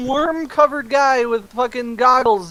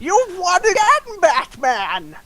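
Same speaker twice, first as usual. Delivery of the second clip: high, drawn-out comic-villain lament; defeated villain; 8 semitones higher, slower than usual. Acted. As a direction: concede theatrically to your nemesis, slowly. Worm villain conceding defeat theatrically.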